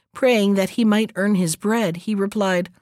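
ordinary delivery

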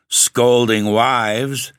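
snarl. A narrator's reading. The genius objects to the Baron's optimism, slow snarl. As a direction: snarl it very slowly, stretching each word. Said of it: "snarled the genius".

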